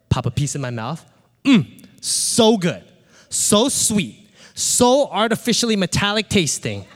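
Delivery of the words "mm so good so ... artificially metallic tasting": mock-blissful relish, higher pitched; hammed-up delight in a treat; savoring. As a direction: savor each bite like forbidden treasure. Acting out savoring stolen gum.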